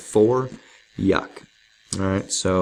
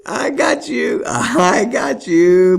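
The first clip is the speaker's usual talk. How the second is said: teasing gotcha; 10 semitones higher, 6 dB louder, slower than usual. Pretend. Teasing after the scare.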